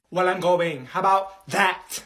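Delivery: loud, defiant, slowing on how about that. Acted. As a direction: throw it back at the teacher loudly. Student defies teacher.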